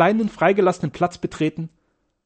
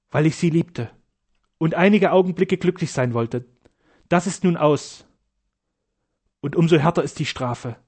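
ordinary delivery, nothing marked

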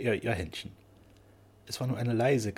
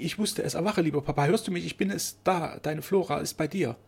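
high, urgent voice of a frightened daughter; anxious pleading; 6 semitones higher than usual. A narrator's reading. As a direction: plead to rouse a fainting father. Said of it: "angstvoll die Arme um ihn".

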